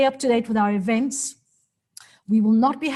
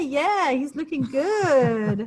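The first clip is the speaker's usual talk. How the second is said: imitating the audience; 4 semitones higher, much slower than usual. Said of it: "People say".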